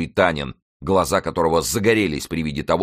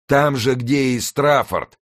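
loud, high, drawn-out cutting retort; sharp voice; 9 semitones higher, 5 dB louder, slower than usual. A narrator's reading. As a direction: throw it out sharply, slow and high. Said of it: "сказал резким голосом Мардаунт". (резким, высоким голосом)

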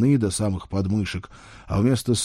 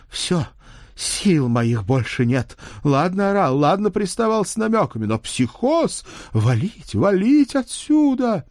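high, loud frantic secretary; panicked woman; 11 semitones higher, 4 dB louder than usual. Acted. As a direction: blurt it out in a panic.

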